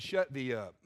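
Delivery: "Shut thee up" said low and dry as a rebuke; imagined rebuke. Shown as a demonstration. Preacher hearing God rebuke Joshua, low curt put-down. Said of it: "all I can hear in my head is".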